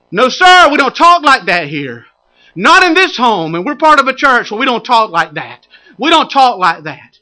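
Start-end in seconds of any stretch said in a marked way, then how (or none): 0.1-2.1 loud, raised parental correction; stern reprimand. Acted. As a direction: put your foot down loudly. Parent correcting talk at home.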